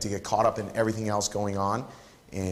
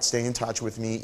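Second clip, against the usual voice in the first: normal talking voice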